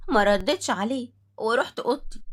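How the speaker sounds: plain reading voice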